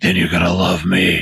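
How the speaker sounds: lightly voiced hero comeback; a hint of a put-on hero voice; imitating Batman. Quoting the reply to the Joker, lightly put-on hero voice.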